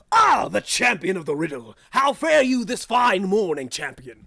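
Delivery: loud booming greeting; grand guardian. Acted. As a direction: hail the champion like a herald. GM voices lions grandly.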